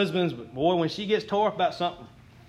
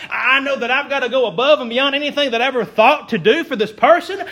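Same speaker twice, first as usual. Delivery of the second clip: louder, climbing resolve; rising fervent preaching; 3 semitones higher, 6 dB louder than usual. Acted. Pastor vowing to encourage a hard person, fervent. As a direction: push it up with conviction.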